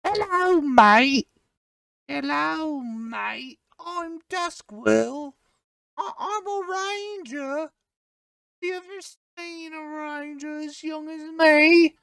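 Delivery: put-on very high, soft little elf kid; tiny squeaky young ranger, kept quiet; Duskwirl. Shown as a demonstration. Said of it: "that's Duskwirl right there".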